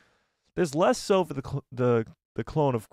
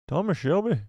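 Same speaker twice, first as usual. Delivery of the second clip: higher, tentative Irish accent; attempted Irish accent; 5 semitones higher than usual. Shown as a demonstration. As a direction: feel your way into an Irish accent. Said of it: "I'll do my Irish accent again".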